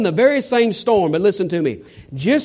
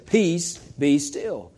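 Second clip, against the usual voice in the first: hushed command; 5 dB quieter, much slower than usual. Acted. Jesus calming the storm.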